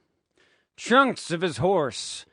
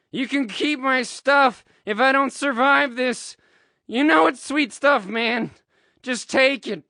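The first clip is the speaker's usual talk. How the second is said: high, raised voice calling to a friend; yelling out; 7 semitones higher, 4 dB louder than usual. A narrator's reading. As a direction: call it out urgently over the noise of battle. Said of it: "he yells out to Harry".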